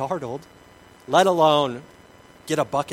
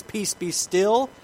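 calm slow command; 6 semitones higher, 4 dB louder, much slower than usual. Retold or imitated. Jesus commanding the storm.

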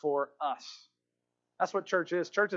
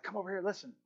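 much softer, secretive summons; urgent whisper; 9 dB quieter, much faster than usual. Retold or imitated. One prisoner beckoning the doctor to listen, whispering. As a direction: keep it hushed and insistent. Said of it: "he's whispering to him".

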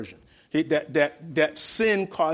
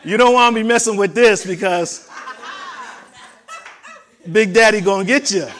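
louder, laughing mock threat from Big Daddy; laughing boast; 6 semitones higher, 9 dB louder than usual. Husband warning wife in jest, loud and laughing. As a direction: ham it up, grinning through a playful warning. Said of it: "You, you can say".

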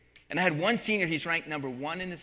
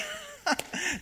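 a squeaky, high mock laugh. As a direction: cackle at him in a high pitch. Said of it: "they laughed too".

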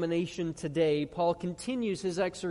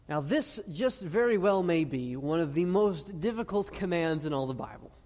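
usual speaking voice

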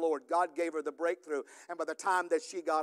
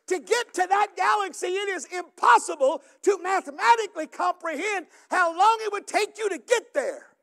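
louder, higher preaching with slow drawn-out phrases; fervent pastor proclaiming to the church; full-force preaching; 10 semitones higher, 9 dB louder than usual. Acted. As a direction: drive the scripture home from the pulpit.

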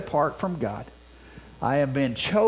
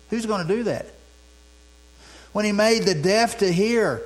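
ordinary talk

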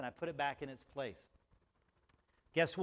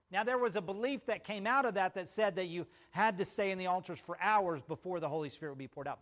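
ordinary talk

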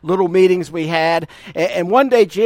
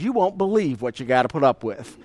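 lower, softer teasing warning; wry aside to wife; 6 semitones lower, 5 dB quieter than usual. Retold or imitated. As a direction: drop it low like a joking confidence. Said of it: "what I was saying to my future wife".

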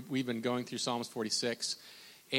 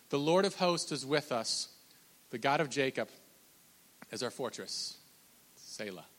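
hushed, falling scripture close; reverent reading; 4 dB quieter than usual. Acted. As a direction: let the verse sink into quiet.

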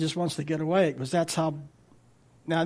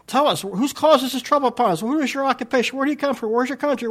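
high, loud, frantic rush of questions; panicked and fast; 7 semitones higher, 6 dB louder, faster than usual. Shown as a demonstration. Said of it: "they're saying this all real fast".

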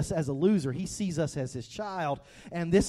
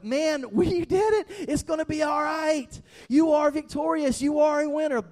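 jubilant cheer; 9 semitones higher, 5 dB louder than usual. Acted. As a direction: celebrate like a coach after the win. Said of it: "that kind of".

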